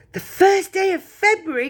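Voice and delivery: high, loud, thrilled cry; a mother crying out in sudden excitement; excited cry. Acted. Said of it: "cried Missus Bucket".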